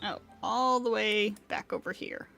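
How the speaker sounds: normal talking voice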